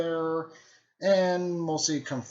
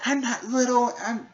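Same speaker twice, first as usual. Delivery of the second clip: put-on much higher and louder, a squeaky kid protest; indignant Naruto; 13 semitones higher, 7 dB louder than usual. Naruto snapping back at Orochimaru, high and squeaky. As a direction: bristle like a hot-headed kid ninja. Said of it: "she's like".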